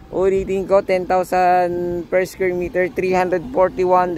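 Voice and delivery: ordinary talk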